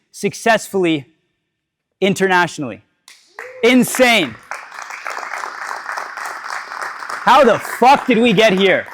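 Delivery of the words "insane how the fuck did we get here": amazed. Real, not acted. Amazed at own success.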